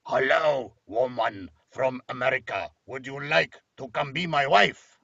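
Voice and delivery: put-on tusked Italian suitor accent. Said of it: "good Italian accent there".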